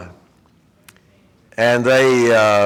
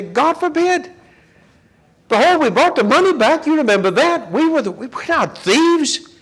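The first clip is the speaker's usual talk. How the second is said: high, pitched-up plea of innocence; protesting brothers; 9 semitones higher, 3 dB louder than usual. Retold or imitated. Brothers protesting innocence to Joseph's servant, high and urgent. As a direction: insist you're no thieves, voice up high. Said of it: "They say".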